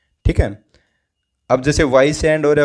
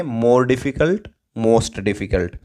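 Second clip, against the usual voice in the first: very slow, word by word: more difficult, most difficult; slow careful teacher model of more difficult and most difficult; correct form; much slower than usual. Shown as a demonstration. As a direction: spell out the right comparative slowly for the class. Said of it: "क्या करेंगे?".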